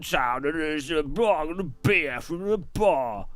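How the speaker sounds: tipsy Cockney geezer in the pub; drunk London accent. Pretend. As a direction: play a Londoner getting drunker. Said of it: "a London accent with him getting more drunk".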